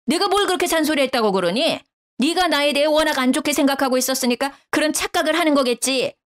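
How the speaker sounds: a touch of wounded indignation; defensive mother-in-law. A narrator's reading. Mother-in-law denies nagging.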